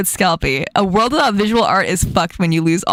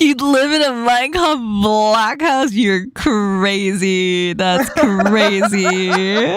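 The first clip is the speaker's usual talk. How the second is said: drawn-out incredulous reaction; amused disbelief; much slower than usual. Real, not acted. Host reacting to a friend's wild choice, incredulous. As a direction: stretch the words out slowly in amazement. Amazed at other player's choice.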